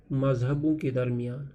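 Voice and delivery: usual speaking voice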